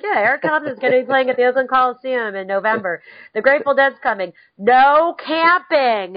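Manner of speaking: deadpan announcer slamming the ban; mock ad read.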